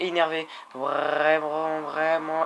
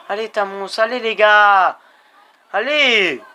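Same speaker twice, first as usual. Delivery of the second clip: slow, loud rally cry, rising into a shout; shouting at the riders to push; loud cheering; 4 semitones higher, 10 dB louder than usual. Real, not acted. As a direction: roar them on to the line.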